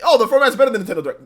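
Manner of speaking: fast, high mocking quote; mocking fanboy. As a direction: parrot them with a sneer.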